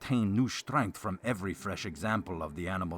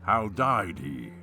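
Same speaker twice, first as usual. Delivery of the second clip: hammy stage actor; 4 dB louder, slower than usual. A narrator's reading. Baron voiced in narrated tale.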